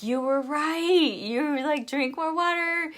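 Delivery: client's excited admission. Retold or imitated. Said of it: "And so she's like".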